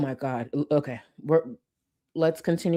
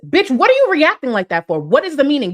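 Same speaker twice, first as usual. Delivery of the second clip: loud, high exasperated rant; outraged yelling; 8 semitones higher, 8 dB louder than usual. Real, not acted. Yelling at the screen over her overreaction. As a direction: yell your disbelief at her. Ranting at streamer's reaction.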